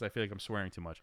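usual speaking voice

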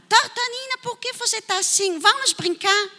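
high, sing-song coaxing call; a child gently asking a friend to play. Acted. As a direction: coax a sad friend to come and play. Friend coaxing a sad Tartanina.

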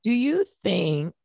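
ordinary talk, nothing marked

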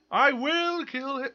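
hammed-up movie villain threat; dramatic Dune quote. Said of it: "That's, that's Dune".

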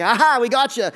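imitated smug triumph; mock gotcha. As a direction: pounce as if catching someone out. Voicing the Pharisees' gotcha thought.

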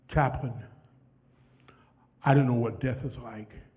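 softly voiced testimony with long pauses; hushed, reverent retelling; retold dying veteran. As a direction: quote a dying man's faith, gently and haltingly. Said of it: "he can barely whisper".

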